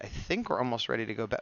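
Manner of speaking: usual speaking voice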